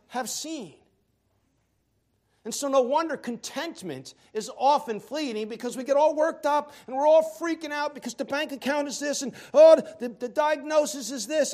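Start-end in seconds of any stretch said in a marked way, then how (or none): 5.5-11.5 mock panic. Mocking panic of worriers.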